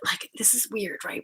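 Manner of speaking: unvoiced confiding whisper. Real, not acted. Telling viewers a secret, in a whisper. Aside about people.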